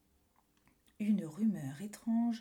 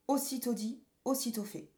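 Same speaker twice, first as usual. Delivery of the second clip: usual speaking voice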